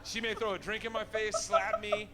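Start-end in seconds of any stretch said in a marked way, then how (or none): 1.4-2.1 sudden high squeak; high yelp of dread; squeaky high. Real, not acted. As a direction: yelp it like you're bracing for a slap. Imagined slap, voice jumps.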